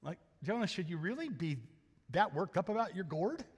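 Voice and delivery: quiet, kindly rebuke; gentle questioning. Retold or imitated. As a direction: ask him softly if he's overdoing it. Paraphrasing God gently.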